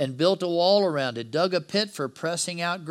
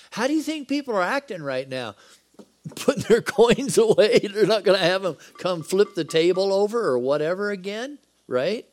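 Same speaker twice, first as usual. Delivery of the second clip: laughing mock; 6 semitones higher, 6 dB louder than usual. Preacher mocking temple crowd.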